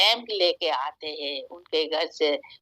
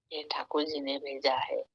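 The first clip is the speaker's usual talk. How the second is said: hushed warning; 2 semitones lower, 7 dB quieter than usual. Acted. Holy man's hushed warning.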